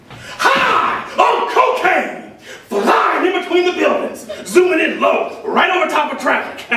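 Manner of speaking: high, loud, whooping boast; drawn-out opening yell, then loud excited rush; wild exhilaration. Acted. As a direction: shout it out, reliving a reckless thrill ride. Character relives wild drugged flight.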